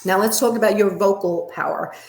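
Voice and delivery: usual speaking voice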